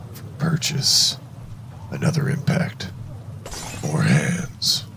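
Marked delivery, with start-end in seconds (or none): none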